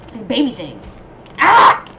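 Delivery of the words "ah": sudden high, loud scream; startled yell. Real, not acted. Gamer overwhelmed by screaming enemies, high loud yell. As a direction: cry out sharply, high and loud.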